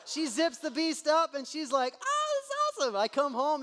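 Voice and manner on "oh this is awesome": put-on very high squealing little girl; squeaky excited girl voice; delighted kid. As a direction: gush like a thrilled child. Daughter delighted with lunch.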